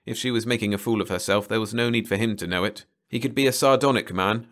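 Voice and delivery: ordinary delivery, nothing marked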